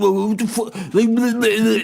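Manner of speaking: hammed-up indignant scoff; mock neighbour. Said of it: "And he's like".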